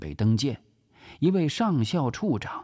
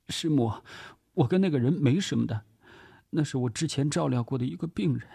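hurried, higher-pitched explanation; 连忙解释; 7 semitones higher, faster than usual. Acted. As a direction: explain yourself quickly in a higher voice. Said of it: "连忙解释着". (急忙解释，语速快，音调升高)

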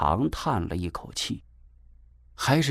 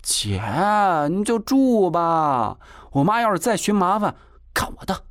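央求; 9 semitones higher than usual. A narrator's reading. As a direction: beg her to stay, stretching the plea. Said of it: "金锁又央求说". (拖长音、高声央求姐姐)

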